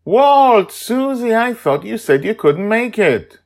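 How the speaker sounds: put-on light up with joy at old friends; brightening up. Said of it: "Sandra notices us and brightens up".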